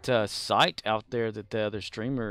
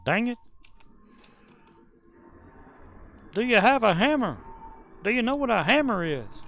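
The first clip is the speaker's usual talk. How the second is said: much higher, pushed cartoon dad voice; 11 semitones higher, 3 dB louder than usual. Streamer keeps riffing on King of the Hill in a high voice. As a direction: squeak it out like a cartoon handyman.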